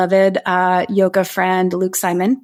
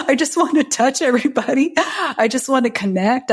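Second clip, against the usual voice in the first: higher, imitating her blissed-out self; a touch of dreamy post-breathwork euphoria, raised pitch; euphoric longing; 5 semitones higher than usual. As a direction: gush it like your heart is wide open. Retold euphoric state after breathwork.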